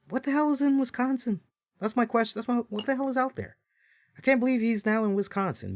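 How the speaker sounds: high, fast bewildered what-the-hell; mock disbelief at Wisconsin; incredulous rant. Real, not acted.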